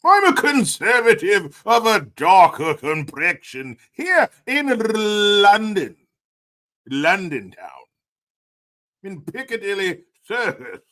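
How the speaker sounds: hammed-up, high-pitched British toff; stiff-upper-lip British. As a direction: declaim in a mock posh British accent. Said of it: "Like your stiff upper lip British accent".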